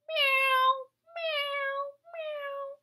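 high meowing, fading out; high cat meows. Pretend. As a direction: meow like a kitten. Imitating a cat.

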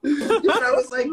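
laughing out the words. Real, not acted. Laughing while telling a friend about the guitar scam.

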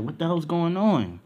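high, loud startled question; startled crew demanding an explanation; alarmed reaction. Acted. Crew reacts to his shout.

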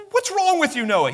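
high, jeering taunt at a fool; mock the crazy boat builder; scornful neighbours sneering at Noah; mocking crowd.

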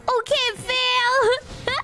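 slow, drawn-out mocking call. Real, not acted. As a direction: stretch it out in mock disappointment. Gamer mocking a failed play.

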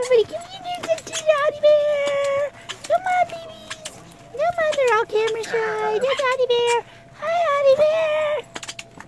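sing-song call to the pets; coaxing pet voice. Real, not acted. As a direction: lure the shy babies over gently. Coaxing pets toward camera.

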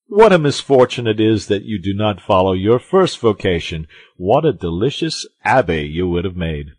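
lightly voiced hearty, admiring musketeer; exuberant Porthos. A narrator's reading. Porthos praises his friend Aramis's wit, exuberant. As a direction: praise it with loud relish at the start.

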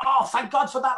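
high, loud cry of relief. Retold or imitated. Actor retelling the end of a take, hugely relieved. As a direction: let the relief burst out. Re-enacts relief after cut.

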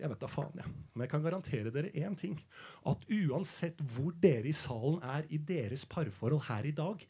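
hushed. Acted. Comedian hushing the couples in the room. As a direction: draw them in quietly. Sudden intimate quiet delivery.

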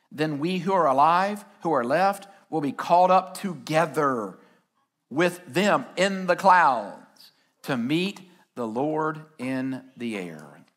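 slow, low, reverent reading of the verse; scripture reading. Acted. Preacher reading the verse to the congregation, slow and reverent. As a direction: let each phrase land slowly.

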